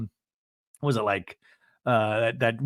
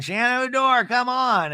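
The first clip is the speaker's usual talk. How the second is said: calling out; 9 semitones higher than usual. Retold or imitated. Group calling her name.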